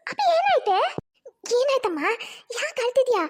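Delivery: high, anxious mother; worried mother. Acted. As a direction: ask what happened, alarmed. Mother asks crying bride.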